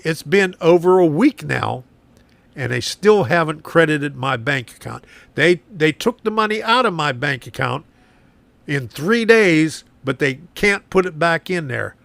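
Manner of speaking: usual speaking voice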